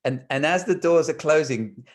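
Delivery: ordinary delivery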